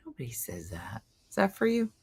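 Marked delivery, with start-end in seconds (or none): 1.3-1.9 higher, easy question with for reduced to "fur". Shown as a demonstration. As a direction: say for as "fer", casually. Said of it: "I say fur".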